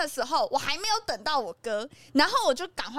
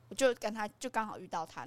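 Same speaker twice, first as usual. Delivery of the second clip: normal talking voice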